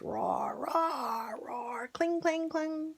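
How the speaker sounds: roars and clangs in a whisper; whispered battle noises. Acted. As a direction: hush the battle sounds. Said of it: "It says".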